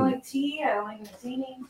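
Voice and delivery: petulant aside; sulky mutter. Acted. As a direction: grumble it half to yourself, high and hushed. Sulky reply about making tea.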